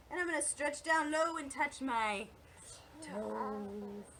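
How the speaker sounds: descending singsong, drawn-out last word. Shown as a demonstration. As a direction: sink the voice down to the toes. Voice descends with stretching down.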